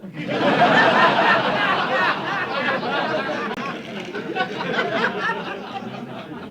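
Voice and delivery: long, high laugh fading out. Real, not acted. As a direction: laugh till it runs out. Long laugh after punchline.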